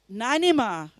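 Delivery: ordinary delivery, nothing marked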